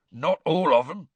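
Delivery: a touch of hoarse half-giant, mildly voiced; lightly rasped, choked-up Hagrid. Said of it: "said Hagrid hoarsely".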